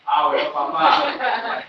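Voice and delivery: loud, slow, high mocking answer; mock loud reply. A student answering the rough caller in a loud mock tone. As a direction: throw it back loud and slow. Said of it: "¿Cómo le va a responder Héctor?".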